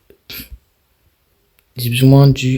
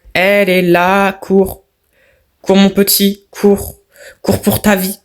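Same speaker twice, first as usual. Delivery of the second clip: higher, hammed-up urging to run; mock panic voice; 7 semitones higher than usual. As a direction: play a frantic guide yelling run for your life. Urging the character to flee.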